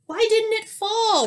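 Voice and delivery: very high, loud disbelief; thwarted schemer's high-pitched question; baffled protest. Acted. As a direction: squeal it in baffled frustration. Skunk baffled that trap failed.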